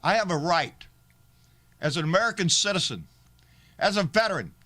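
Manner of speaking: proud declaration. Real, not acted. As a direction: proclaim your rights with pride. Proud loud declaration over music.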